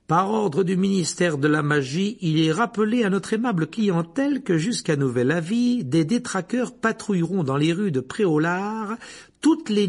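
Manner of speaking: loud, high-pitched announcer voice; official proclamation. Acted. Ministry warning to shop customers, loud formal proclamation. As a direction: proclaim it at full force like a public notice. Reads the posted official notice aloud.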